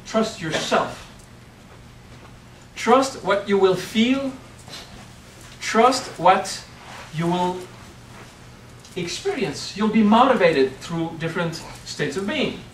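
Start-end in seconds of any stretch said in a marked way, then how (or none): none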